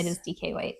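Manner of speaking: soft conspiratorial whisper. Real, not acted. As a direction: whisper it back, playing along. Echoes the playful whisper.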